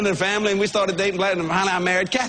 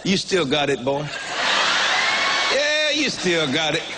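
lower, slow, smug pep talk; self-flattering swagger; 5 semitones lower, slower than usual. Retold or imitated. Preacher boasting to himself, slow and lower. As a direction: talk yourself up like a charmer. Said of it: "I start believing them in myself".

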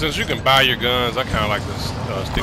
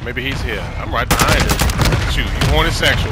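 excited shouting; 5 semitones higher, 6 dB louder than usual. Real, not acted. Hunting an enemy in game.

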